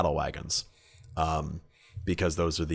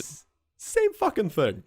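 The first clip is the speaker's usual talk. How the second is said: incredulous; 12 semitones higher than usual. Real, not acted. Exasperated about plagiarism.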